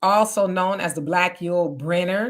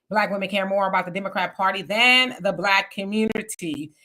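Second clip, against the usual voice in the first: ordinary talk, nothing marked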